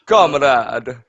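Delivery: put-on hammy Russian accent. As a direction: say it like a Soviet comrade in a Russian accent. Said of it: "I need to do Russian accent".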